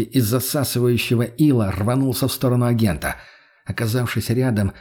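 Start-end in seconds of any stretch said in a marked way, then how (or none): none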